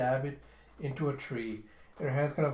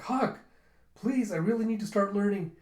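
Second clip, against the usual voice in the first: insistent higher-pitched plea; pleading; 7 semitones higher, 5 dB louder than usual. Retold or imitated. Girl begs Huck to let her start learning, pleading. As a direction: beg earnestly, pitched up and louder.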